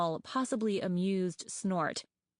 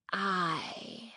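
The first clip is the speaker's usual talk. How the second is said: eerie rasp. A narrator's reading. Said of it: "creaky and quiet and rough and eerie".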